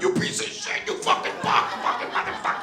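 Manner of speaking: high strained cursing, half laughed; mock fighter trash talk. Comic rant as a raging cage fighter. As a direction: spit insults like a furious brawler.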